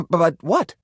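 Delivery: ordinary talk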